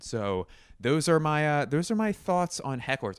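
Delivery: hammed-up closing line; mock announcer sign-off. Wrapping up topic.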